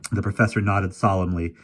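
plain reading voice

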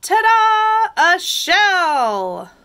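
high, loud ta-da fanfare; big storybook flourish, last word drawn out; triumphant reveal. A narrator's reading. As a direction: present it proudly like a magician.

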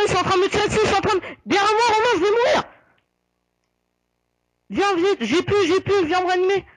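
high frantic plea for help; panicking gamer. Real, not acted. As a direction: beg for a revive in panic. Dying teammate begging for revive.

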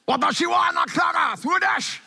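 high mock-Japanese shout; comic high-pitched yell in mock Japanese; yelling Japanese lover. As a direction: holler like a lover calling from far off. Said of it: "I know it's a Japanese couple".

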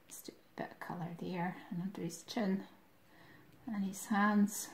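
fast whispered aside while drawing. Real, not acted. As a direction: murmur it to yourself, quick.

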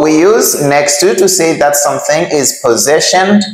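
usual speaking voice

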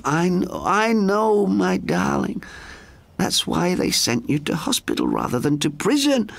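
high, tender motherly sister; calm him like a mother; gentle high voice comforting her brother; soothing. A narrator's reading. Frances calming him.

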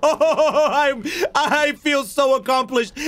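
slow, high, laughing with joy; laughing triumph. Real, not acted. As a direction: gloat through laughter after the win. Just killed the boss.

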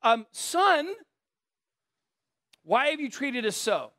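Mary asking kindly, lightly voiced; gentle mom voice. Preacher voicing Mary gently asking her son, light mom voice. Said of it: "So she goes".

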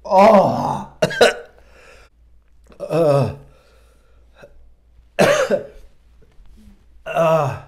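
gasping through a burning mouth; pained groans after super-hot sauce; mouth burning from spicy food. Real, not acted. Reacting to the extreme hot sauce burn.

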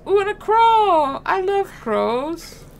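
high, loud excited coo; light up with sudden delight; delighted surprise. Real, not acted. Player spotting a favorite bird, delighted surprise.

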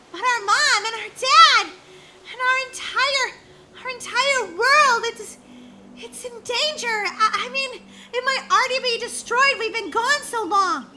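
high, loud, emotional plea; urgent distress. Acted. Girl begs sister to save their world, distressed. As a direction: plead in alarm for your family. Pleads that home is in danger.

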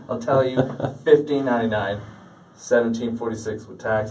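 usual speaking voice

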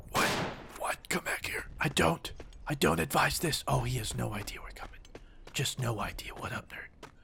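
soft, unvoiced sneaking whisper; sneaking through the trenches, whispering; stealthy whisper. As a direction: whisper like creeping up on an enemy.

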